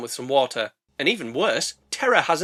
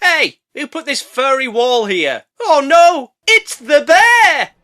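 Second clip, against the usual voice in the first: loud, high slow cartoon terror; hammed-up panic; 9 semitones higher, 10 dB louder, slower than usual. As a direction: scream on realizing it is the bear. Character panics seeing bear.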